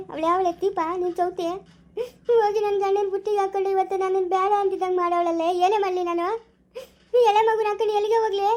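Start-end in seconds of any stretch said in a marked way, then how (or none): 2.3-8.5 crying, pitch rising in despair; abandoned wife sobbing to her sister; sobbing lament. Acted. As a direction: wail it out through tears.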